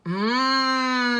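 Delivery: long humming M, lips closed; the M sound. Shown as a demonstration. Teacher humming the M sound for pupils. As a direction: hum the M steadily. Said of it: "lowercase m".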